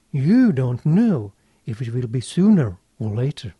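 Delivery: ordinary talk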